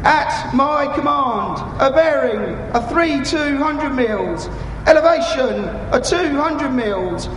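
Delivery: slow, measured fire mission orders; calm radio call for fire. Acted. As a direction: read each order out steadily. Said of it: "You will hear me talking to them".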